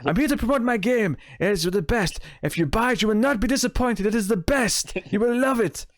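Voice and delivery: high, hammed-up bad French accent; shitty French accent. Podcaster mocking a game maker's boastful plug, in shitty French accent. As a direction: mimic an overeager salesman in a poor French accent. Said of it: "I'm doing kind of a shitty accent".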